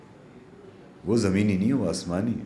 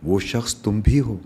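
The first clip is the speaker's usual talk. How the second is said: louder, measured spoken-word delivery; slowing on the closing lines of each verse; poetic recitation; 6 dB louder, slower than usual. Acted. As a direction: recite it like a poem to an audience.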